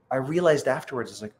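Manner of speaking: ordinary delivery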